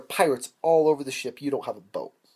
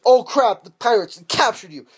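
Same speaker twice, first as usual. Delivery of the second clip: loud, high burst of dismay; hammed-up sudden shock; mock alarm; 8 semitones higher, 8 dB louder than usual. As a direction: blurt it in pretend panic. Acts out sudden capture.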